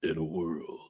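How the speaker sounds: very low epic announcer; movie trailer voice. Acted. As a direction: go as low as you can. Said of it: "You get as low as you can".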